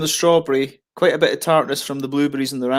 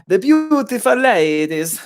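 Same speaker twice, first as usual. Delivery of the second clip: high, slow, hammed-up Italian accent; 7 semitones higher, slower than usual. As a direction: play a comic Italian, stretching the words. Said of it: "the Italian guy. It's like".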